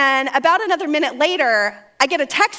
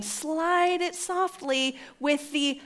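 softer, drawn-out words; sly slow-motion; 3 semitones higher, 5 dB quieter, much slower than usual. Acted. Wife slyly sending caller's name along, slow and soft. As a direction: stretch it out stealthily.

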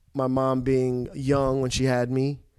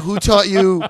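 louder and higher, pointed; pointed question; 5 semitones higher, 9 dB louder, slower than usual. Retold or imitated. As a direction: fire the question back. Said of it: "she was like".

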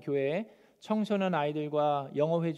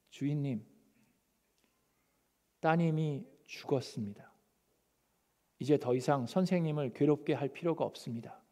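quiet, grave report; 3 semitones lower, 6 dB quieter than usual. Acted. Servant reports daughter's death.

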